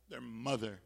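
usual speaking voice

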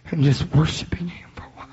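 hushed, quick breathy whisper; worshipful whisper. Real, not acted. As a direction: whisper it as if bowing at his feet. Preacher sinks into worship.

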